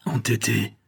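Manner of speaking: ordinary delivery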